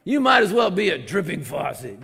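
mock angry scripture-throwing; angry jab. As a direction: hurl the verse like an insult. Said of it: "throwing scripture out in anger".

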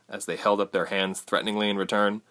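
plain reading voice

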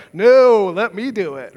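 a loud, lightly acted "No" of protest, then plain; mock refusal. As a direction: briefly play him pushing the help away, half-serious. Jesus imagined refusing service.

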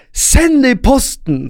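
high, drawn-out yell; retold defiant shout. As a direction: holler a parting retort, stretching it out. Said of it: "roper jeg bare".

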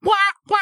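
mock beeping like a game ping; imitated ping sound. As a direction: mimic an annoying ping, wah wah. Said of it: "every ping is a bing or bah".

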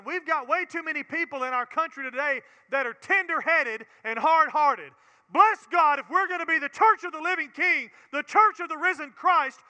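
fiery revival preaching; preaching at full force. Acted. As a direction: thunder it from the pulpit. Preaching climax.

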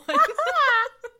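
high burst of loud laughter. Real, not acted. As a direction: crack up out loud. Big laugh after quote.

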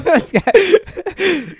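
high laugh over the words. Real, not acted. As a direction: crack up saying it. Laughing at friend's claim.

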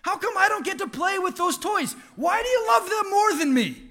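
high, shouted wail of a jealous little one; toddler tantrum. Retold or imitated. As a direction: pretend to be a toddler crying out that it's unfair. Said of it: "he's like".

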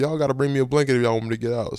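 fast, loud shouted demand; yelling. Retold or imitated. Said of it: "And I started yelling".

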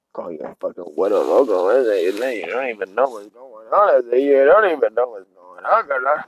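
low singsong Chicano homie; low mock Chicano lilt. Mocking homie character voice.

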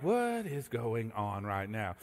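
spooked hush in the dark; nervous whispered question; creeped-out murmur. Retold or imitated. Creepy noises in the night.

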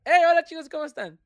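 bright youtuber intro, retaking; upbeat channel greeting, then scrapping it; mock video intro; reading a script aloud. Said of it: "leo un párrafo".

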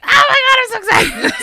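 high laughing gush of excitement; giddy squeal. Retold or imitated. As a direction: squeal it out like a thrilled fan. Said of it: "I was just like".

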